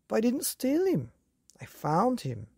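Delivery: put-on pleading young boy. A narrator's reading. Boy protests to father.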